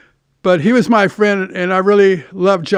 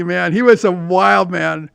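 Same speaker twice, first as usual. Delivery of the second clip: usual speaking voice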